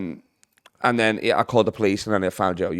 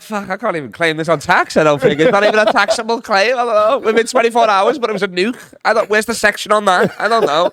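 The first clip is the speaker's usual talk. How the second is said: mock panic; 9 semitones higher, 4 dB louder than usual. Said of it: "I'm like".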